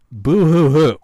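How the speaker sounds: loud, high mocking fake sobbing; mock boo hoo. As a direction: sob it out to mock the whiners. Mocking people who complain.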